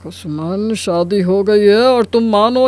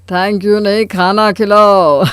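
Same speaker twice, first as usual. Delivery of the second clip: ordinary talk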